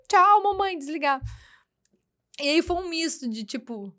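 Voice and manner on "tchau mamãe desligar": put-on high, loud toddler goodbye. Mother imitating her son ending the call. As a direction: play the little boy hanging up on mom. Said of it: "Então ele".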